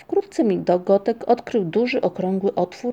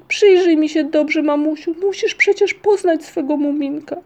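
high, urgent plea to mother; acted desperate child's appeal; outburst; 8 semitones higher, 6 dB louder than usual. A narrator's reading. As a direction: beg loudly to be recognised. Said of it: "wybuchnął Muminek".

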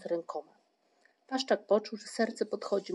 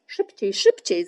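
loud, drawn-out urging; insistent raised-voice encouragement; 9 dB louder, slower than usual. A narrator's reading. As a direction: egg someone on loudly. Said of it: "zachęcał go Włóczyki".

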